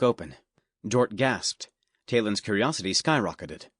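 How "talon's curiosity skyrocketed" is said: ordinary delivery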